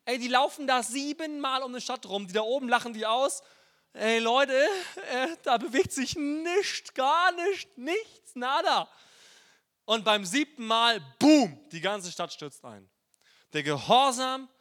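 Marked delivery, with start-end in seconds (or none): 3.9-8.9 high, loud jeering taunt from the walls; mock mocker. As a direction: call out as a scornful onlooker. Jericho walkers shouting up, mocking.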